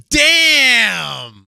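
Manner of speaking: high, loud, drawn-out mimicked exclamation; mock delighted outburst. Retelling daughter's outburst over a sweater, loud mock exclamation. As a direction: imitate a kid blurting it out loudly.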